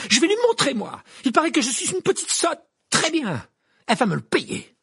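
very high, loud furious cry; piercing rage. A narrator's reading. Hermione defies Rita in the street, in piercing rage. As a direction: shriek it in defiance. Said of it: "d'une voix perçante en tremblant de rage".

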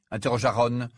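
ordinary delivery

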